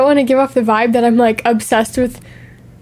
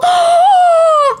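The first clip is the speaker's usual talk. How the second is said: loud high gasp of delight; excited gasp; 4 dB louder than usual. Acted. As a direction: gasp as if overjoyed.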